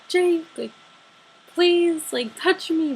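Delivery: high, loud fangirl begging; retold starstruck plea; excited pleading. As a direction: call out desperately to your idol. Retold begging of idol at concert.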